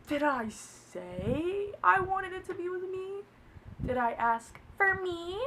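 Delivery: slow, high incredulous question; offended. Retold or imitated. Answering his rejection, slowly incredulous. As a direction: draw it out, affronted and rising. Retold offended comeback, first mild version.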